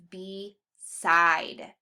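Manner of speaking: BESIDE slowly split as "bee... SIDE"; split at syllables. Shown as a demonstration. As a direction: say BESIDE in two parts, long E then stressed SIDE. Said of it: "It's an open syllable".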